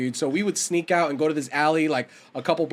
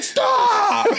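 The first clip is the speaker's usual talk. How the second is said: high shouted stop, breaking into a laugh; friend shouting stop; 4 dB louder than usual. Retold or imitated. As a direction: yell it to stop the fight. Friend shouting in retold fight.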